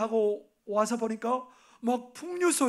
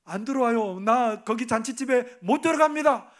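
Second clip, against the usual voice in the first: stubborn refusal; 4 semitones higher, 6 dB louder than usual. Acted. Elder son stubbornly refusing to join the feast, loud. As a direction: plant your feet and refuse out loud.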